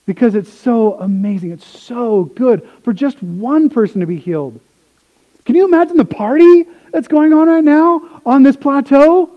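ordinary talk, nothing marked

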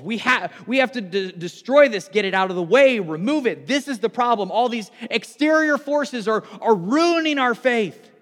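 a prophet thundering against the enemy; fiery prophet's call. Acted. Prophets' violent call voiced.

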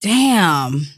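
ordinary talk

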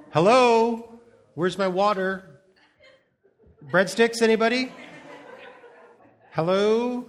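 loud, high mock calls for the waiter; calling out for service. Pastor playing an entitled diner calling out at home, loud mock indignation. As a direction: holler indignantly into an empty house like a neglected diner.

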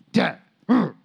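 mock sulky grumble; grumbling kid. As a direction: imitate a mad kid grumbling at dad. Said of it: "He grumbled".

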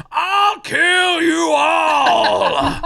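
high, drawn-out hammed-up yell; villain's shout. The sheriff threatening everyone, high slow hammed-up shout. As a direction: bellow it like a blood-soaked sheriff. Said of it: "he goes".

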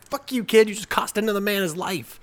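high, loud mock yell; the detective yelling at the kid, mock angry. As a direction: snap at the kid, hammed-up. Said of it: "you're just like".

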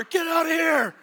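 high-pitched comic panicked shout; re-enacted yelling from the camel's back; retold yelling. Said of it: "I'm yelling at him right here".

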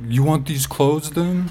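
a hint of a confused stranger asking; lightly voiced old man. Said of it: "and he's like".

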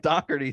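"Doherty" spelled out as DOCK-ar-tee; demonstrated pronunciation. Shown as a demonstration. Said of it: "saying his name is Doherty".

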